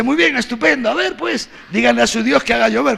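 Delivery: high, mocking challenge, quick at the end; mocking taunt. Elijah taunting the prophets of Baal, high and mocking. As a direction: goad them with a sneer.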